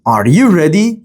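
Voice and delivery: "Are you ready" swung upward as a question; rising intonation. Shown as a demonstration. Teacher giving a yes-no question example, rising intonation. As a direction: let "Are you ready" rise at the end. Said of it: "The intonation goes up, yes, no".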